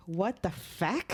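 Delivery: slow, drawn-out Irish bartender voice; a rough stab at an Irish accent, slowed right down; attempted Irish accent. Irish bartender baffled by a daiquiri order, in an attempted Irish accent. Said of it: "I can't do an Irish accent".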